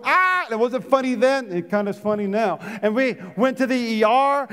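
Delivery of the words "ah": retold cry of pain. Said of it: "I'm going".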